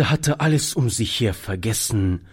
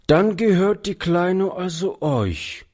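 put-on a boy's higher voice, let down; visibly disappointed; 8 semitones higher than usual. A narrator's reading. Boy to old man, learning the child is his: disappointed. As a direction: sound crestfallen that the child is theirs. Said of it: "sagte er sichtlich enttäuscht".

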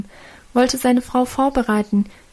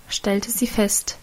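ordinary delivery

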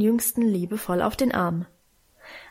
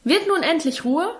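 raised-pitch, exasperated demand; scolding father; 7 semitones higher than usual. A narrator's reading. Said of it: "schalt Vater in erhöhter Lautstärke".

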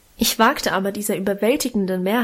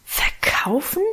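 slow, horrified whispered question; shocked whisper; 4 semitones higher, slower than usual. A narrator's reading. Children whisper back in shock at Guste. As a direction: breathe the word in dismay. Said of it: "raunten und flüsterten wir alle entsetzt".

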